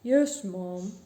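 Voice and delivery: low, loud dutiful reply; obedient butcher. A narrator's reading. Butcher answers the bossy lady, obedient.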